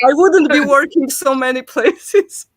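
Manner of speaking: loud, high, laughing complaint; laughing protest. Real, not acted. Wife joking about working many places, high and laughing. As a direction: protest loudly, breaking into laughter.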